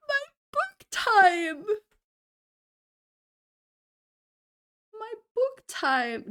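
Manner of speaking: high, drawn-out lament; hammed-up heartbreak, stretching the words slowly; mock-sad wail. Streamer mourning a removed channel reward, mock-sad wail. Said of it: "I was actually so sad".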